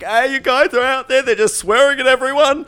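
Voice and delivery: high, loud tattletale voice; mock complaining caller. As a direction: squeal on them like an outraged caller. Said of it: "I'll call the charity and be like".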